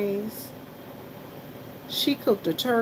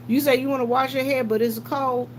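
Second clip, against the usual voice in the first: ordinary talk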